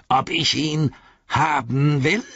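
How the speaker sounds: the orc leans in, hungry; eager orc voice. A narrator's reading.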